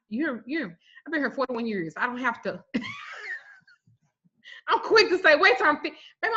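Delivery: ordinary delivery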